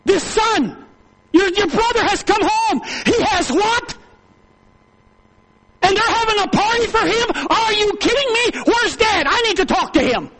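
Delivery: high, loud outraged disbelief; indignant brother. Acted. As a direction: play the brother who can't believe it. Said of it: "One of his hired servants says".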